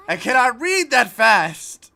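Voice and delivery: loud, high, drawn-out mock protest; mock outraged yell. Streamer protesting that she can't keep up reading, mock outraged yell.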